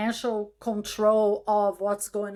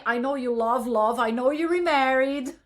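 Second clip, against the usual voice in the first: usual speaking voice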